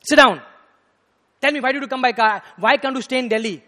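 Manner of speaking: acted-out angry telling-off; angry guru. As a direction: order and question sternly at volume. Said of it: "got more angry".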